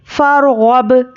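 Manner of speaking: फरगब drawn out, echo on the final ब; slow recitation. Shown as a demonstration. Teacher shows students the qalqala, slow chant. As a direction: chant फरगब slowly with a bounced ब. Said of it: "इस तरह से".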